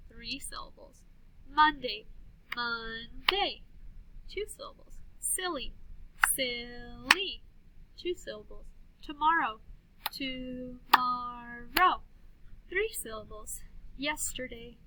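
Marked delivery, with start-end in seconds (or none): 2.6-3.6 MONDAY slowly in two beats, mon-day; slow "Mon-day" for clapping; clapped syllables. Shown as a demonstration. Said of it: "then we'll clap the syllables". 6.3-7.4 SILLY drawn out as sil-ly; slow "Sil-ly" for clapping; clapped syllables. Shown as a demonstration. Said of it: "then we'll clap the syllables". 10.1-12.0 TOMORROW very slowly, to-mor-row; stretched-out "To-mor-row"; clapped syllables. Shown as a demonstration. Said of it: "then we'll clap the syllables".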